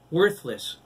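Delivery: loud, high jeering word; echoing taunt. A narrator's reading. Girl echoes the insult, loud and high. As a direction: throw the word at her.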